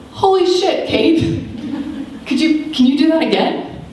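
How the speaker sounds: high, amazed retelling of dad. Retold or imitated. Dad stunned by his daughter's kick, high amazed voice. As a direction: gasp it out like you can't believe it. He goes.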